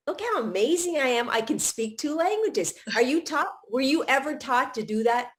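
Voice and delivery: ordinary delivery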